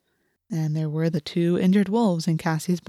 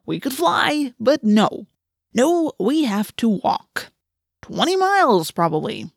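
high, drawn-out griping; sarcastic complaint; 5 semitones higher, slower than usual. A narrator's reading. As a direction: moan theatrically about a long slog. Marco complains about the hike.

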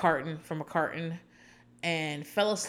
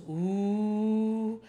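mock shocked ooh; 3 semitones higher than usual. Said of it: "And John goes".

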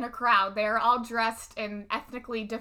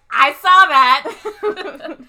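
loud, slow, laughing recognition; excited; 5 semitones higher, 16 dB louder, much slower than usual. Retold or imitated. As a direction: blurt it out with a laugh.

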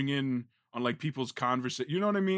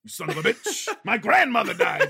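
higher, laughing mock outrage; mock angry outburst; 9 semitones higher, 6 dB louder than usual. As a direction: blurt the insult angrily, half laughing. Replaying skit line in outburst.

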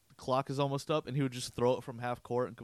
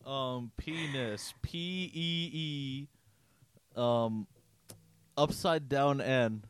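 imitating a teacher who can't spell, very slow; slow mock spelling; much slower than usual. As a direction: spell penis very slowly, letter by letter. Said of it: "could barely spell".

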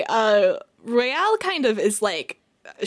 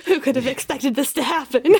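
higher, louder faux innocence; sarcastic mock surprise; 4 semitones higher, 5 dB louder than usual. Royale's inner voice, louder and higher, feigning surprise at the fallout. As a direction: play dumb with heavy irony.

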